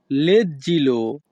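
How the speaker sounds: very slow, careful teacher pronunciation; slow Italian model word. Shown as a demonstration. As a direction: stretch the word for learners. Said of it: "Italian ভাষা leggilo".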